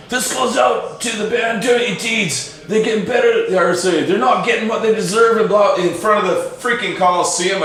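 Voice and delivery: dedicate the song like a frontman; stage dedication. Retold or imitated.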